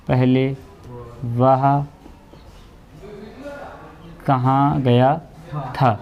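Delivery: slow, word by word with long gaps; dictating. Shown as a demonstration. Teacher dictating the Hindi sentence to students, slow word by word. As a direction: give each word alone so the students can write it down. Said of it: "teacher dictating the Hindi sentence".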